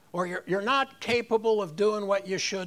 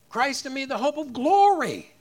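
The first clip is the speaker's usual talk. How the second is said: GLORY raised high and loud at the end; stress on GLORY; 4 semitones higher, 6 dB louder than usual. Shown as a demonstration. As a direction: save the punch for GLORY. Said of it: "Put the emphasis on each one of the words".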